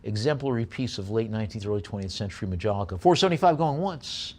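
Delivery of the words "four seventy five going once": brisk auction chant, pushing for bids; auctioneer bid call. Real, not acted.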